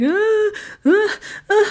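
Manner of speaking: very high, choked scared gasps; too frightened to speak; boy pointing at the ceiling, crying out in terror; terrified cries. Retold or imitated. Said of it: "like something scared the crap out of him".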